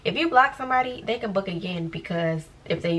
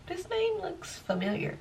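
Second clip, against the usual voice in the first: puzzled recognition; 10 semitones higher, 9 dB quieter than usual. Retold or imitated. Recognizing a troublesome name.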